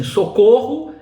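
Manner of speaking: "socorro" called out high and loud like a cry for help. Shown as a demonstration. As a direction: shout "socorro" as if calling for help. Exclamation read as cry.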